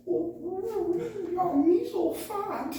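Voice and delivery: high, slow mocking voice; mocking imitation. Cook mocks big Drew behind his back, high and slow. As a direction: ham up a mean imitation of a big guy. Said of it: "He goes, starts mimicking him".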